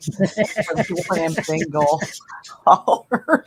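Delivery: breaking up laughing while reading out. Real, not acted.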